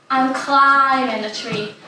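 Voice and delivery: ordinary delivery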